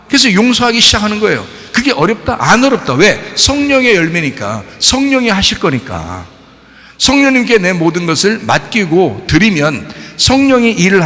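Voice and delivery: preaching at growing force; rising preaching. Acted. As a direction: build it up from the pulpit. Preaching builds up. (점점 힘을 실어 외치는 설교)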